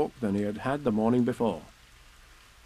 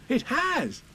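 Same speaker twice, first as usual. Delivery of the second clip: put-on high-pitched boyish agreement; eager boy; 14 semitones higher than usual. A narrator's reading.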